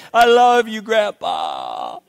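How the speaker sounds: a light imitation of a sweet little grandson; cute grandkid voice. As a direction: sweetly mimic the small boy on the roof. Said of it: "in his cute little way and say".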